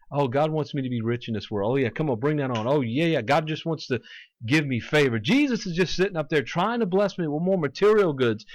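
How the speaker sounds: sarcastic, rising prosperity-believer enthusiasm; mock eagerness. Preacher mimicking believers who want riches from God, mock eagerness.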